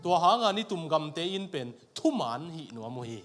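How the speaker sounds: usual speaking voice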